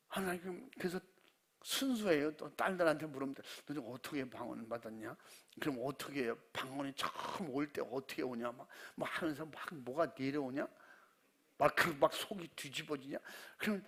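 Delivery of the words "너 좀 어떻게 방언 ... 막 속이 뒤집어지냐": 낮고 작은 목소리로 몰래 묻듯이; 딸에게 속삭이듯 조심스럽게; hushed asking. Retold or imitated. Father quietly asks daughters. (딸들에게 방언 받는 법 묻는 아빠, 낮고 조용하게)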